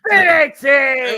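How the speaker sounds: loud, slow, high shout; excited shout. Real, not acted. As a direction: yell the move name out. Big move called live.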